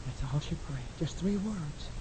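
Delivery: very soft and low; hushed. Real, not acted. Preacher tells congregation of her short prayer, hushed. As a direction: bring it right down, almost under the breath. Hushed aside after the acted cry.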